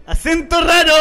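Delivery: loud, high mock-indignant cry; hammed-up scandalised exclamation; mock outrage. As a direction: play up the shock at his own words. Mock outrage at own remark.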